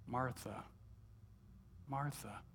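a lightly voiced Jesus, soft and gentle on her name; tender. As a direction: say her name like a mild, loving rebuke. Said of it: "He spoke my name tenderly".

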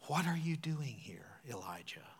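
God's question in a soft whisper; gentle whisper. Acted. God whispering to Elijah at the cave mouth, hushed. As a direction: breathe the question, barely voiced. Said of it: "came a gentle whisper".

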